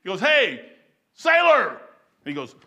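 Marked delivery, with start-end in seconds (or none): 0.2-1.7 loud, raised-pitch hail to someone high up; acted admiral hollering up to a sailor; calling out.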